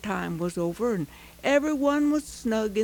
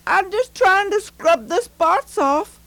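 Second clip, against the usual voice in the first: put-on earnest boy; 6 semitones higher, 6 dB louder than usual. A narrator's reading. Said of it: "Pete replied".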